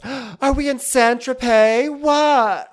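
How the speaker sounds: high, loud, drawn-out amazement; Sonja impression. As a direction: cry it out high and slow. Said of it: "And Sonya goes".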